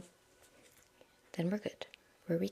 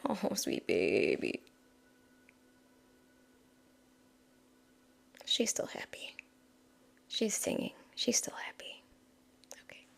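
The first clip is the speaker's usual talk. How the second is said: tender whisper; 11 dB quieter than usual. Real, not acted. Talking tenderly to broken crystal.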